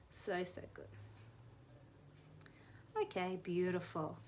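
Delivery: hushed relaxation coaching; guided relaxation. Acted. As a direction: ease them into calm. Guides ear massage softly.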